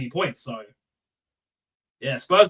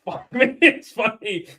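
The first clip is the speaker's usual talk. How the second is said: laughing; 6 semitones higher, 4 dB louder, slower than usual. Real, not acted. Still laughing through words.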